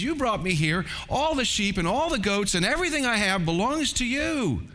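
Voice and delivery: hammed-up self-abasing plea to Uncle Abram, pitched well up; mock humble nephew. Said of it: "if he were a godly, humble man".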